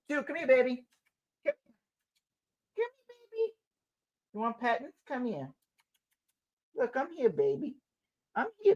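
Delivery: high, soft cooing at a pet. Real, not acted. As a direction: coo to the puppy, sweet and soft. Baby-talking to the dog.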